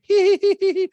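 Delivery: very high, loud put-on laugh; piercing hammed-up titter; mock falsetto giggle. [name] laughs at her own remark, piercing mock giggle.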